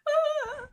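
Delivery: high, drawn-out "ah"; mock high-pitched wail; real-life reaction. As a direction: let out a long, high, soft cry. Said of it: "In real life, Alex, I'm going".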